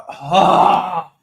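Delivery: high pained oh of despair; anguished fan groan; real groan. Real, not acted. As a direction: cry out in dismay. Groan at another back pass.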